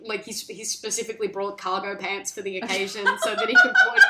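ordinary talk

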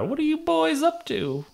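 put-on old lady voice.

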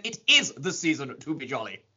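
over-enunciated posh English, stressing IS; put-on posh accent with a punched IS; mock posh voice. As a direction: say every word in full, poshly. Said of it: "we gotta do a Jack's posh voice".

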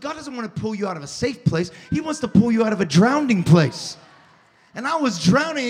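usual speaking voice

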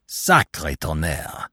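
slow, low French oath of surprise; ejaculated oath. A narrator's reading. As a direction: swear in understated astonishment. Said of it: "ejaculated the Frenchman".

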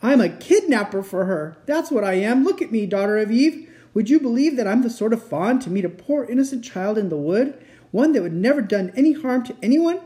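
high, agitated confession; anguished fawn, self-accusing. A narrator's reading. Fawn admitting he kidnaps children, anguished.

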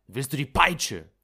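laut und hoch gedroht; wütende Drohung. Acted. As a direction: droh ihm zornig mit der Peitsche. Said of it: "schrie ich wütend". (der Arzt droht dem Knecht laut und wütend)

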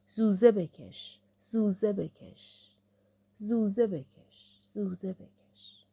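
slow, drawn-out refrain dying to a whisper; chant-like echo trailing into a whisper; fading refrain. Acted. Recited refrain fading away to whisper.

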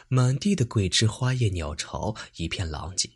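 ordinary delivery

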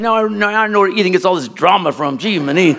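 usual speaking voice

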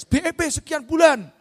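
high, loud sales pitch; salesman's shout. Acted. Franchise seller hyping the break-even promise to buyers, loud shout. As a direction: call it out like a hawker.

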